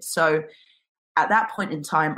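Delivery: ordinary talk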